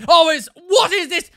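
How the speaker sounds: very loud, high imitation of an outraged plumber; mock shouted demand; shouting. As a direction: bellow it slowly, appalled. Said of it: "shouting at someone in his own".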